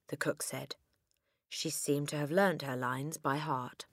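ordinary delivery

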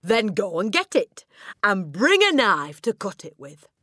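high, very loud order; loud command. A narrator's reading. Headmistress ordering cook to fetch cake, commanding loudly. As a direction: send them off with a shout.

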